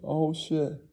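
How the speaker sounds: high mock bragging; lightly hammed-up show-off; rich rival.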